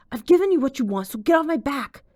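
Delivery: loud, high, fed-up yelling; angry. A narrator's reading. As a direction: snap back hard and loud. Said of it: "The voice grew angrier".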